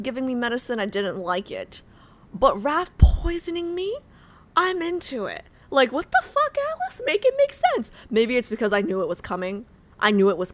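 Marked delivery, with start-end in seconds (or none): 2.3-7.9 high, loud, disbelieving squeal; overexcited fangirl outburst; incredulous excitement. Real, not acted. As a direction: shriek in delighted disbelief. Baffled by liking the poisoner.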